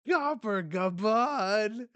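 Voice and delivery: loud, high, drawn-out name; hyped announcement. Acted. Manga fan revealing Gabon's arrival, hyped shout. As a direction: belt out the name slowly.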